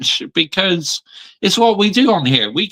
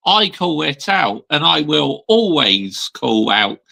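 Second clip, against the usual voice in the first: usual speaking voice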